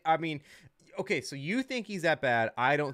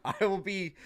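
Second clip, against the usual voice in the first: laughing; 5 semitones higher, 4 dB louder, much slower than usual. Real, not acted. Admitting he needs the points.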